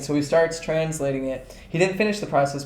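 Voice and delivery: normal talking voice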